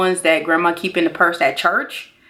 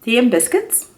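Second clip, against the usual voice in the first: "Tea and biscuits?" tried in a higher, mock British London accent; bad London accent; 6 semitones higher than usual. Shown as a demonstration. Vlogger trying a London accent for the candle name. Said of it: "My accents aren't that great".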